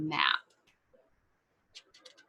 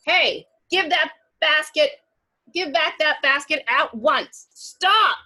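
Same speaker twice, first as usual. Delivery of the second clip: higher, loud demanding shout; angry shout; 8 semitones higher, 6 dB louder than usual. Acted. Friends shouting at the wolf to return the basket. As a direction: order him to stop.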